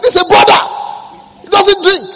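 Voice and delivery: high, loud insistent shouting; retold shout. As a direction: shout him down with conviction. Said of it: "I was shouting that is a brother".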